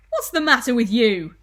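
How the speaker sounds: loud, cross question; irritated demand. Acted. Husband asking his screaming wife what's wrong, irritated. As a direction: call it out loudly, annoyed.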